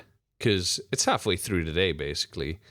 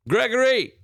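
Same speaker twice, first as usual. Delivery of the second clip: calling out; 12 semitones higher, 4 dB louder than usual. Acted. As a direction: shout Gregory, much higher and louder. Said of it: "He goes like this".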